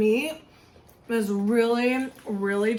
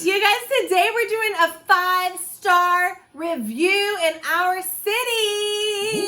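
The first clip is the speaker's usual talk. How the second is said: high, singsong excitement; bursting with excitement; excited; 8 semitones higher, 4 dB louder, slower than usual. Real, not acted. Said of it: "I'm super excited".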